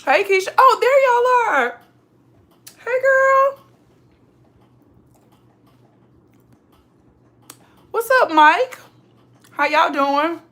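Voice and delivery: high, loud, thrilled hello; excited greeting. Real, not acted. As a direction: greet them like long-lost friends. Greeting viewers who arrived.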